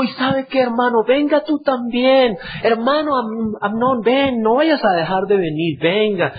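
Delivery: false friendly invite. Retold or imitated. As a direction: sweetly call the brother over. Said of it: "Y dijo".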